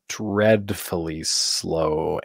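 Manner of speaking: slow, stretched-out words; dragged out slowly. Shown as a demonstration. Editor describes a slow take, dragging delivery. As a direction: drag each word to show sluggish pacing.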